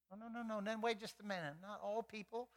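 soft, urgent murmur of a mother holding on; hushed protective mother. Retold or imitated. Mary quietly objects to the shepherds, holding her baby close.

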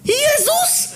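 extremely high falsetto shriek; startled squeal. Acted. Villain seeing his escaped prisoner, startled squeal. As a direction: squeak the name in shock. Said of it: "pisnął Kojtyła".

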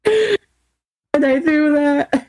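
drawn-out goofy innocence; Urkel-like line. Pretend. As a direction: play the nerdy sitcom catchphrase. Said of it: "Urkel catchphrase".

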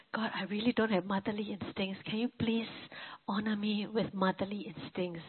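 softly pleading with God; quiet prayer. Retold or imitated. Mother in labor begs God for motherly instincts, hushed. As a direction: murmur the plea, barely above breath. Praying at the delivery bed.

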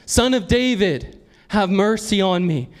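high, insistent calling plea; crying out. Acted. Blind man calling Jesus over the crowd's objections, crying out. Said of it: "he cried out".

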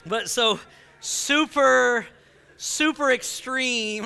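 ordinary talk

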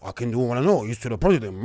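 low mocking cat-like impression of a senator; deep mocking McConnell voice. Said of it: "You got Mitch McConnell".

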